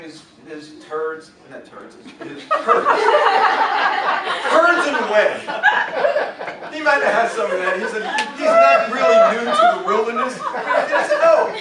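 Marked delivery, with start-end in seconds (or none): 2.3-11.5 high, loud, laughing through words; can't stop laughing at the slip. Real, not acted. Cracks up at own slip.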